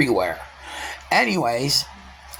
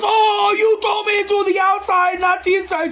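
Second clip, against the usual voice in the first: much higher and louder defensive shout; coworker shouting back; 3 dB louder than usual. Retold or imitated. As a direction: fire it back in his defense.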